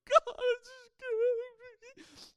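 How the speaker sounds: very loud, high, wailing imitation; tearful cry to God. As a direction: bawl out a hammed-up prayer. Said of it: "that's a beautiful response to God".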